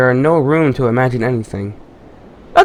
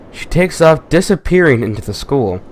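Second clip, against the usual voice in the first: usual speaking voice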